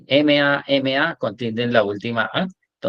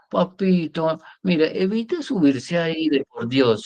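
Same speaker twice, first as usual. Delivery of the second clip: mock pleading parent; 5 semitones higher than usual. Shown as a demonstration. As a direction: beg the child in an exaggerated wheedle. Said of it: "Y tampoco". (súplica melosa fingida)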